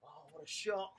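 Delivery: ordinary delivery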